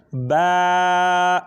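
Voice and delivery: با drawn out to a full alif length; long baa. Shown as a demonstration. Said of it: "ایک الف کے برابر اس کو ہم کھینچتے ہیں". (با کو ایک الف کے برابر کھینچ کر)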